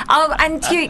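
ordinary talk

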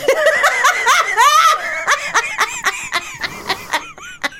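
very long, high cackling laugh; uproarious laughter. Real, not acted. Cracks up at mental image.

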